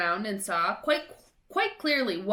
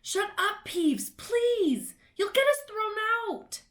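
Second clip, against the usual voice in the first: much higher, urgent pleading; desperate plea; 12 semitones higher, slower than usual. A narrator's reading. Pleading with Peeves.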